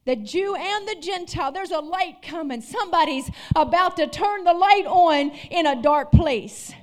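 loud, rising sermon cadence; fired-up pulpit preaching; preaching at full force. Acted. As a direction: preach it to the whole room.

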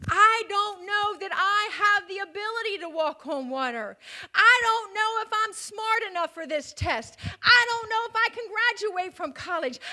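full-force preaching over doubt and fear; preaching climax. Acted. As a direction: build the preaching to a shout. Preaching builds to a peak.

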